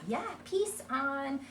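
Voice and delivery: high, slow, sing-song "peace on"; expanding modeling. Shown as a demonstration. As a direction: stretch the child's words playfully. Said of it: "we can start expanding on that".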